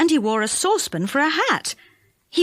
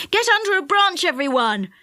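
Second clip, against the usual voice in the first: higher, urgent call to duck; yelled warning; 7 semitones higher than usual. A narrator's reading. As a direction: yell a quick warning. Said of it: "yelled Joe".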